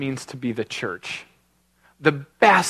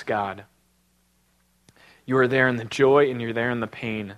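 closing prayer; 4 semitones lower than usual. Acted.

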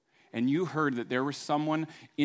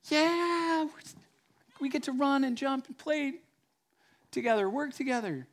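much higher, elated voice; excited friends; 11 semitones higher than usual. Retold or imitated. Friends on the roof celebrating his legs, elated. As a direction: bounce with joy at your friend walking.

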